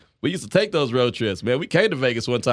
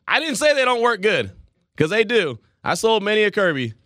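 emphatic; 6 semitones higher than usual. Real, not acted. Defending Kirby vacuums, raised voice.

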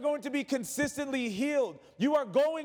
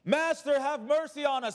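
raised pleading cry for mercy; 6 semitones higher than usual. A narrator's reading. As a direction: call out for mercy from afar. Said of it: "they lifted up their voices and said".

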